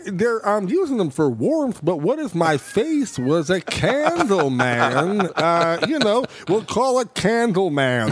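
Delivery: lightly voiced spacey stoner; slow dazed pitch, half-high; Jeff Bridges impression. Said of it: "I was like".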